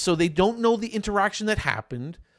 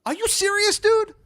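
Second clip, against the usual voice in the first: incredulous; 4 dB louder than usual. Retold or imitated. Retold incredulous reply.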